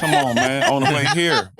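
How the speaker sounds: laughing while talking; amused laugh on the words. Real, not acted. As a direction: chuckle through the words. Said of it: "laughing at his own story".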